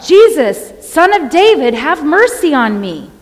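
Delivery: loud, high, desperate cry for mercy. Retold or imitated. Blind Bartimaeus calling again over the crowd, crying out loudly. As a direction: cry out at full voice, falling at the end. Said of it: "he cried out".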